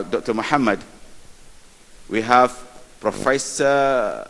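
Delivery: ordinary talk, nothing marked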